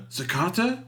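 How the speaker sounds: high, rising questioning call; puzzled recognition. Acted. Sleepy escapee hears a familiar voice, calls her name questioningly. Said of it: "And then he's like".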